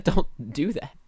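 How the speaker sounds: usual speaking voice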